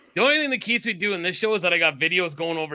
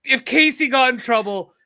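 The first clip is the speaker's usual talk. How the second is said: ordinary delivery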